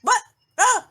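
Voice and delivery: loud, high calls into nobody there; exasperated caller. Acted. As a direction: call out impatiently for an answer. Acts out exasperated caller getting no answer.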